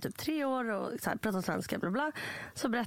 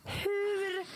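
baffled how; 10 semitones higher than usual. Retold or imitated. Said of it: "man bara".

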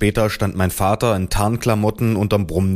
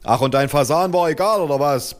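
higher-pitched accusing retort; mild bellow; 5 semitones higher than usual. A narrator's reading. Father accusing mother over the pheasant, mild bellow. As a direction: fling it back without much extra volume. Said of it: "brüllte mein Vater".

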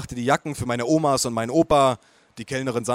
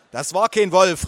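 mother calls out, higher and louder; a raised protest from across the table; calling out; 6 semitones higher, 5 dB louder than usual. Acted. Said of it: "rief meine Mutter".

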